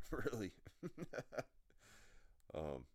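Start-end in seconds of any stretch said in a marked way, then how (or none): 0.6-2.3 high, breathy giggle; real laugh. Real, not acted. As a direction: giggle on, trailing away. Laughs at own remark.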